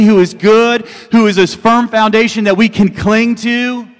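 high, loud, slow impassioned preaching; loud rising sermon over music; preaching at full force. Acted. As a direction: build to a fervent climax.

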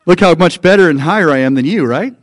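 loud, quick pretend gloating; mock boasting. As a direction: play at lording it over someone.

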